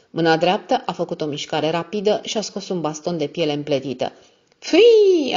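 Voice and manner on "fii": sudden yell. Acted. Drunk man lashing out.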